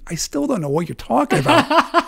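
high, loud, giggling confession; amused bewildered thought; laughing confusion. Retold or imitated. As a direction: laugh at being lost.

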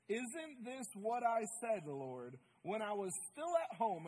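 loud, slow, indignant protest to God; a loud, slow, aggrieved prophet; angry complaint. Acted. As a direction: throw the grievance back at the Lord, loud and drawn out. Jonah's angry prayer voiced.

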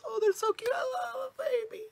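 put-on very high, squealy baby talk; cooing at babies. Someone seeing little babies, high gushing coo. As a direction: gush and squeal, stretching the words. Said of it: "when you see little babies".

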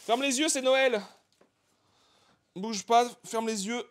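high, loud eager urging; excited. Real, not acted. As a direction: hurry her eyes shut gleefully. Surprise reveal for partner.

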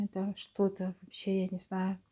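ordinary talk

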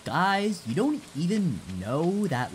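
lightly voiced influencer, rising ends; mock the streamer slash influencer accent; influencer up talk. Mocking influencer up talk to chat, a light put-on. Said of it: "like, up talk".

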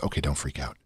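low, soft note to self; hushed self-talk. Retold or imitated. Telling herself to stay cool near a celebrity, low and hushed. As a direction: drop right down, keep it under your breath.

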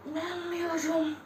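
very soft, low disbelief; stunned hush. Real, not acted. Streamer stunned by nine thousand viewers, very hushed. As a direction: barely voice it, stunned.

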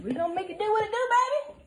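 high, fast hype-up. Real, not acted. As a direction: pump herself up, rising. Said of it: "hyping herself up".

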